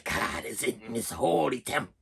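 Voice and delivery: put-on slow, hushed, eerie old preacher voice; creepy preacher impression. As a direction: intone it low and slow like a sinister pastor. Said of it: "he's like".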